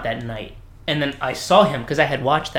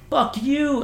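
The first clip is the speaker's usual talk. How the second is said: angry; 12 semitones higher than usual. Retold or imitated. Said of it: "And he said".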